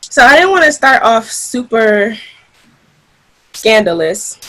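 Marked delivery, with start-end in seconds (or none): none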